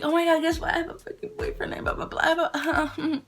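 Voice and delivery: high, giddy gabble, imitated; freaking out. Telling people about her new boyfriend, freaking out. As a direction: gush it high, running into babble. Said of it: "I was, like, freaking out".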